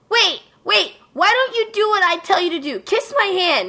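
put-on high girly voice, imperious and quick; bossy vampire girl. Vampire girl ordering Kohei to kiss her hand, high bossy girl voice. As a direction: play the spoiled little vampire ordering her slave.

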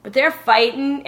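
usual speaking voice

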